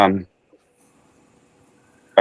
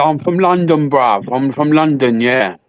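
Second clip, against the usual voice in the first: mock London accent; 3 semitones higher than usual. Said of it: "One that goes".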